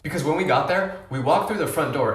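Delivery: ordinary delivery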